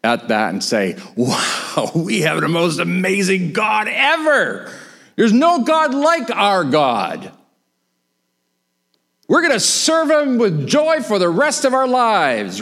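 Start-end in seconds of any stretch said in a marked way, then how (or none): 1.2-12.6 high, swelling exclamation of praise; overjoyed awe. Acted. Israelites after the sea collapses, acted jubilation. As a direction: build from an amazed wow to jubilant proclamation.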